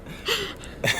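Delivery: breathy knowing laugh; high unvoiced giggle. Real, not acted. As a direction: laugh before she even says it. Anticipating the bio joke.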